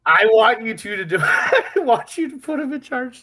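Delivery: high, loud real laughing speech; helpless laughter pushing the voice up high. Real, not acted. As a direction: squeal it out through real laughter. Cracking up at the suggestion.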